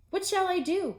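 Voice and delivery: high, loud despairing cry; cried out. Acted. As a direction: wail it in despair. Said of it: "cried Toad".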